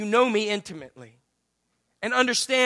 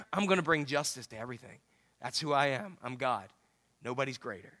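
hushed, much lower voice; quiet assurance; 9 semitones lower, 10 dB quieter than usual. Acted. God telling the congregation who he is, quiet and low. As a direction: sink low and soft, with calm authority.

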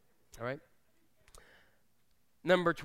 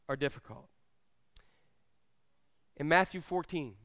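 ordinary talk, nothing marked

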